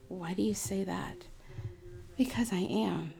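whispered exchange; hushed whisper. Retold or imitated. Therapist whispering as she retells the client's question. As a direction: whisper it as if confiding.

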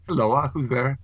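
"Hello, uh" as one word with an added syllable; "Hello, uh" said as in old stories; old-fashioned hello. Shown as a demonstration. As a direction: echo "hello-uh" as an archaic greeting. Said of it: "trying to pronounce hello like they do".

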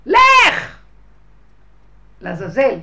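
all-out scream to a tormentor; full scream. Retold or imitated. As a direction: explode and throw him out. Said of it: "ככה אני אומרת לו".

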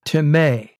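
"Timē" ending in a stressed MAY; pronunciation shown. Shown as a demonstration. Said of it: "is the way you say that".

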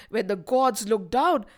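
plain reading voice

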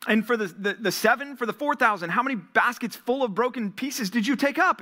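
earnest preacher voicing Jesus' rebuke; Jesus rebuking. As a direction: press the questions like a grieved teacher. Preacher voices Jesus questioning disciples.

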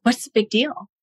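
lightly voiced husband brushing it off; husband shrugging off her upset, mildly dismissive. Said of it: "he's like".

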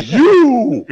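loud, high, drawn-out shout; single them out with a bellow; imitating a wrestler's accusing roar; imitated menacing yell. Imitates wrestler pointing and yelling.

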